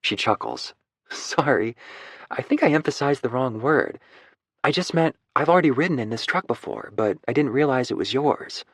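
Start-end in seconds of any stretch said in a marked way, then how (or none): 1.1-8.6 higher, apologetic Lucy explaining; higher voiced, sheepish apology; Lucy's voice. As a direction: play the woman smoothing over a slip.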